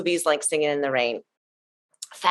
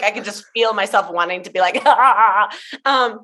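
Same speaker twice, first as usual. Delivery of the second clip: normal talking voice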